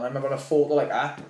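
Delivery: usual speaking voice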